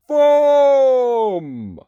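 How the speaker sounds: long, high booming shout; loud giant's cry. A narrator's reading. Narrator finishing the giant's chant, booming loudly. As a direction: stretch it out and let it fall. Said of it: "a loud".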